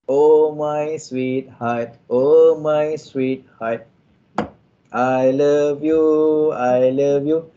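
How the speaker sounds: very slow, drawn-out endearments; sweet loving call. Pretend. Wife sweetly greeting husband coming home, slow and loving. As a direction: coo it out slowly like a doting wife. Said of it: "isteri akan panggil apa?".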